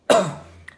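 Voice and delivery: single forced cough; cough on request. Real, not acted. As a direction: cough once on cue. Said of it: "can you cough for me".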